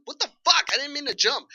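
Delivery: annoyed at the controls, blurting; mock frustrated outburst. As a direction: gamer cursing a missed jump, high and loud. Frustrated at random parkour jump.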